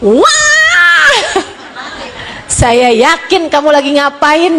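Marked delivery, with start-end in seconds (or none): 0.0-1.2 imitated high-pitched bawling cry; sudden loud-mouthed "waah" like a child really crying; mock wail leaping up high and held; serious child's wail. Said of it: "begitu anak nangisnya serius nih".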